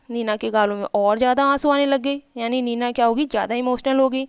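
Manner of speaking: ordinary talk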